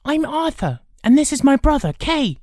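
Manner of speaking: put-on high-pitched boyish introduction; friendly boy. Boy introducing himself and his brother, friendly. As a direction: introduce yourselves in a high boy's voice.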